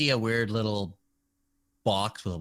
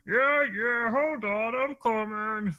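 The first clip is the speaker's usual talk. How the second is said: much higher put-on voice, calling out; high gruff worker voice; 12 semitones higher, slower than usual. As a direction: shout from behind the door, pitched up. GM voices worker behind door.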